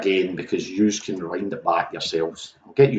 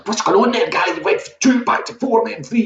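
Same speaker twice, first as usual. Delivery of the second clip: higher mock-puzzled viewer voice; confused viewers. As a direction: play a baffled viewer calling out the host. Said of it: "People were in the".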